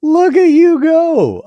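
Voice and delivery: very high, loud, drawn-out wow; real amazement. Real, not acted. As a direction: cheer them on, stunned and impressed. Amazed by co-host's recitation.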